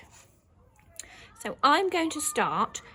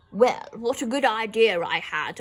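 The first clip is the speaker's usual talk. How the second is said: smug dad; 5 dB louder than usual. Shown as a demonstration. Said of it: "Dad might be saying".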